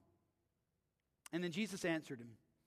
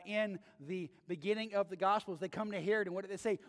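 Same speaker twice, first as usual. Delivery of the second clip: ordinary delivery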